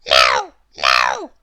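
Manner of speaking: very low, loud little man voice; Mister Small asking. Acted. Lost Mister Small asks for help, very low voice. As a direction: ask politely in a deep voice. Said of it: "said Mister Small".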